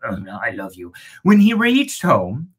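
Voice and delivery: usual speaking voice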